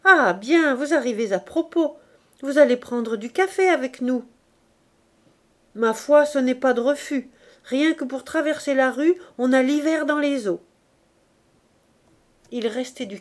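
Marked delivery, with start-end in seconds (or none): 0.0-4.2 louder welcome, a little higher; hearty welcome. Acted. Gervaise invites Virginie for coffee, heartily. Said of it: "s'écria Gervaise". 5.8-10.6 a touch higher and louder; lightly voiced Virginie. Virginie accepts the coffee, lightly voiced.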